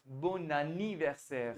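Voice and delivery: "bon anniversaire" drawn out very slowly, linked; teacher says "bon anniversaire" very slowly; slow French model phrase. Shown as a demonstration. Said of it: "repeat कीजिए, बोलिए".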